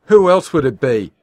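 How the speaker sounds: very loud, high and distraught; tearful shout. Acted. Wife shouting at husband through tears, distraught.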